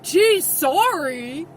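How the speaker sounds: loud, slow, mock apology; sarcastic apology. Owner answering a stranger's blaming look, sarcastic. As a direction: drag it out with heavy sarcasm. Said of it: "I'm like".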